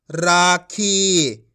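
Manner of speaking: राखी very slowly, both vowels held; drawn-out long vowels. Shown as a demonstration. Teacher models the joined word, vowels drawn out. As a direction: stretch राखी out syllable by syllable. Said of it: "खींच के पढ़ेंगे".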